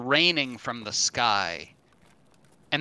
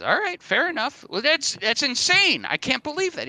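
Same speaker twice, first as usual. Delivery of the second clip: high, incredulous voice; stunned guy; 14 semitones higher, 4 dB louder than usual. Acted. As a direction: still reeling from the news.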